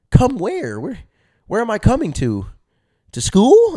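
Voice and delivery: loud, high-pitched incredulous questions; hammed-up naive shock; utterly baffled. Naive kid baffled in retold talk.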